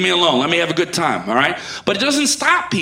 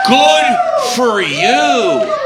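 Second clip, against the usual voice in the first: sarcastic; 6 semitones higher, 5 dB louder, much slower than usual. Acted. Sarcastic drawn-out retort.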